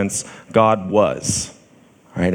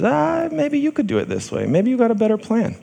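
very high, falsetto-like inner tempter; tempting voice; 12 semitones higher than usual. Acted. Voice in your head offering another plan, high tempting voice. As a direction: suggest it in a tiny high-pitched voice. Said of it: "hear a voice that says".

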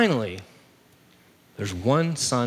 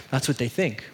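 ordinary delivery

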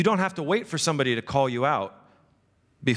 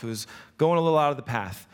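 normal talking voice

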